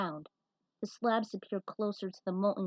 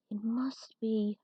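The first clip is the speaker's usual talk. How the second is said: slow breathless whisper; slower than usual. Acted. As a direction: barely breathe the words. Whispered realization.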